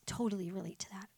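whispered echo of "Totally relate to that"; hushed aside "Totally relate to that". Real, not acted. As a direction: repeat "Totally relate to that" under your breath. Repeated line, whispered echo.

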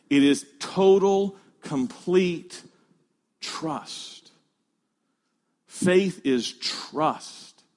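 spaced-out words with weighty pauses; slow emphasis. Real, not acted. Preacher defining saving faith for the congregation, slow and emphatic.

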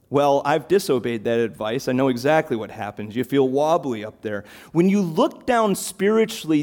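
ordinary delivery

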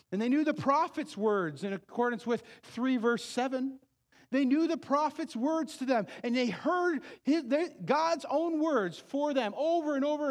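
loud, high fervent preaching; preaching at full force. Acted. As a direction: drive the warning home to the congregation. Preaches to the congregation.

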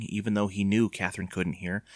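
ordinary delivery, nothing marked

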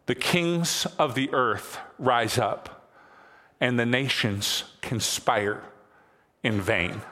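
slow, solemn reading of the psalm; solemn scripture reading. Acted. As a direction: read each phrase slowly, with weight. Reads scripture slowly.

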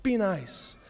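slow, mock-gentle nice-guy voice; Mister Rogers. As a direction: draw it out like a mellow, harmless peacemaker. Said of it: "cool first century Mister Rogers guy".

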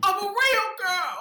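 high, slow delighted squeal; Pinocchio voice. Pretend. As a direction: squeal like a puppet come alive. Jubilant exclamation.

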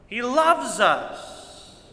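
he loves us, drawn out slowly; slow, emphatic he loves us; slow tender stress. Real, not acted. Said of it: "preacher drawn out".

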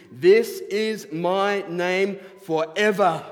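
loud, slow, each word weighed; solemn slow proclamation. Acted. Pastor voicing God to Moses, slow and loud. Reading God's words to Moses.

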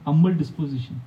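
normal talking voice

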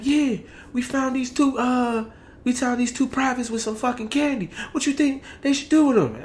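high, mocking address to the company; drill sergeant voice. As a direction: taunt the troops like a drill sergeant.

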